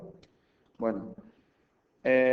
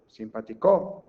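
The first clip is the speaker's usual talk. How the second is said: simpatiCO, loud final CO; Simpático misstressed as simpatiCÓ; wrong stress on last syllable; 2 semitones lower, 6 dB louder, slower than usual. Shown as a demonstration. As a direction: punch the CO at the end of Simpático.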